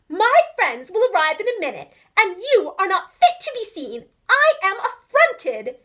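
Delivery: loud, high, indignant scolding, slowing on the last words; affronted mother. Acted. Missus Tabitha scolds her messy kittens, loud and indignant. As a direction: scold them in outrage. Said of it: "said Missus Tabitha Twitchit".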